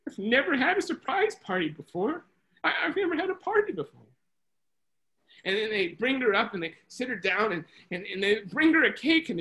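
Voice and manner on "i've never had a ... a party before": high-pitched imitation of a tearful woman; mock tearful. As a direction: act overcome at a first party, lightly tearful. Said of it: "she starts crying".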